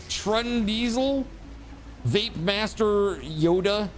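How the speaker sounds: usual speaking voice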